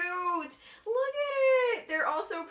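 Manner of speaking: very high, very slow gush; gushing coo. Real, not acted. Vlogger showing viewers cute cover art, slow high gush. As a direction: melt over how pretty it is.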